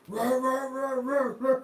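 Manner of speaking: loud, high barking roars; loud, high mock roars of a charging dog; imitated dog roaring.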